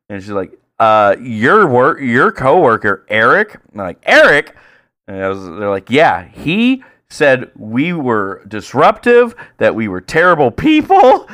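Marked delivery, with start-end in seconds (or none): 0.8-3.6 slow, drawn-out mock complaint; slow, pointed, slightly raised voice; accusing. Acts out the complaining woman. 4.0-4.5 high, loud questioning echo; acted high-pitched bafflement; incredulous. Acts his puzzled reply to her. 5.9-11.3 drawn-out, rising mock outrage; high, slow, affronted complaint; indignant. Acts out the offended women.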